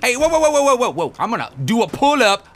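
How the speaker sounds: high, loud mock-tough yelling; loud taunts. Player taunts game enemies in a shootout, high loud yelling.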